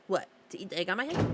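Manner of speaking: ordinary delivery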